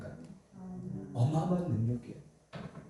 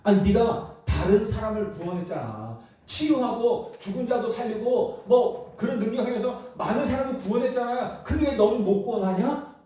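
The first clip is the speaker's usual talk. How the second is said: higher-pitched, scornful challenge; mocking; 6 semitones higher than usual. Preacher voices scoffers asking why the healer can't save himself, mocking. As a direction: taunt him with his own good deeds. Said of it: "이렇게 조롱하는 거죠".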